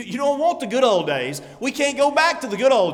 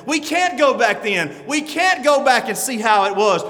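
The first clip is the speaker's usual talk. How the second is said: louder, building pulpit voice; fervent preaching; 4 semitones higher, 4 dB louder than usual. Acted. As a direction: press the point on the congregation. Preaching with rising force.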